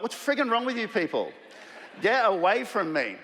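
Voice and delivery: high-pitched mock outrage at sick people; exasperated outburst. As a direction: shove them away with your voice. Another colleague sick.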